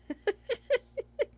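high-pitched embarrassed giggle; sheepish laugh after botching the word. Real, not acted. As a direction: laugh at yourself for failing. Laughs at own failed R.

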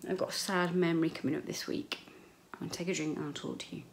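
ordinary talk

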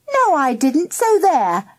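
high, defiant comeback; childish retort. A narrator's reading. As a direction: answer back like a squabbling child. Child answers back in squabble.

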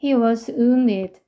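he was wounded read very slowly, word by word. Shown as a demonstration. Teacher reads the phrase to students, very slow. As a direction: spell out each word slowly for learners. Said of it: "But, 그러나".

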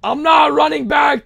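loud, high, drawn-out shout; stubborn high-pitched holler; shouted refusal. Real, not acted. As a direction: dig in and yell it slowly. Mock-outraged refusal to chat.

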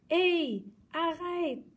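high, loud stop cry; shouting. Acted. Girl yelling as the dog runs off with her kite, loud cry. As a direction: yell after the dog. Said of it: "a crié la fillette".

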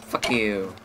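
usual speaking voice

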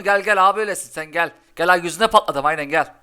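loud come-on to the opponent; loud taunt. Real, not acted. Streamer egging on the opponent's deck, loud taunt. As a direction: dare them, loudly.

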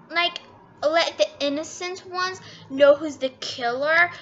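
normal talking voice

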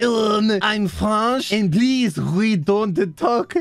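exaggerated French accent, very drawn out. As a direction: put on a thick, slow mock French accent. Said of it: "impossible not to read this with a French accent".

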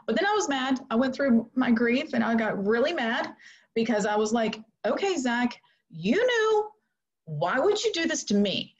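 high, strained grieving outcry; anguished anger. Retold or imitated. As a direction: pour out the anger and hurt at him. Grieving mother angry at son.

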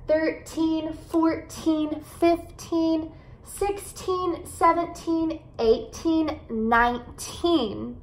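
put-on drawn-out TEEN endings from THIRTEEN through NINETEEN; stress on final syllables. Shown as a demonstration. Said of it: "enfatizar esses sílabos, é, sílabas no final".

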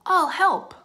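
high, loud, excited offer; eager. A narrator's reading. Francine jumps in on Arthur's plan, eager. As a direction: pipe up eagerly, volunteering at once.

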